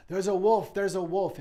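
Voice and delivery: very high, loud crying-wolf shout; mock alarm cry. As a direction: scream a false alarm in the street. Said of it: "a crime to come out into the street saying".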